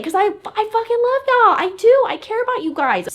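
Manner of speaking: gushing. Real, not acted. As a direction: pour it out to the fans, rising. Gushes love to her viewers.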